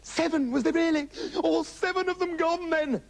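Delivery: high, lamenting cry; hammed-up high-pitched despair; wailing mother. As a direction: wail it as a bereft mother. Mother wails on hearing seven.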